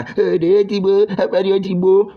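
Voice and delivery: high-pitched mock victim's complaint; playing victim. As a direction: imitate someone crying victim, pitched well up. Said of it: "playing victim".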